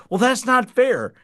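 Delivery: put-on high, loud childish complaint; whiny protest. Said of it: "She goes".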